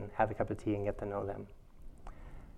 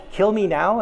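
higher, louder hammed-up plea; mock anguished cry; 10 semitones higher, 14 dB louder than usual. Teacher mocking her own itch panic, loud comic wail. Mind going nuts over itch.

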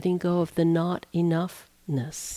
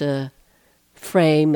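ordinary delivery

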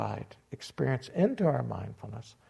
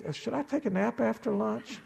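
laughing mock thought; 9 semitones higher than usual.

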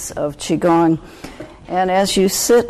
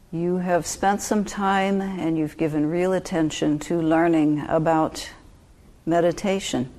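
calm meditation guide with long pauses; unhurried, even instructions with space to breathe; guided meditation; 3 dB quieter than usual. Real, not acted. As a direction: ease listeners into stillness, phrase by phrase.

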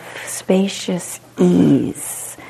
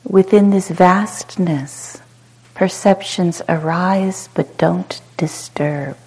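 calm, unhurried meditation guide with long pauses; short soothing phrases, falling gently into silence; guided meditation; 13 semitones higher, 5 dB quieter than usual. Real, not acted. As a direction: guide them into spacious ease, letting each phrase settle.

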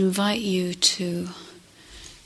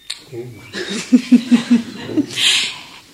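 hearty, drawn-out laugh; 2 semitones higher, 5 dB louder than usual. Real, not acted. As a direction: burst out laughing at your own joke. Joke about eyes of Jesus.

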